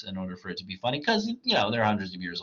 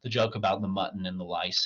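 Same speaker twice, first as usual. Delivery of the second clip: ordinary delivery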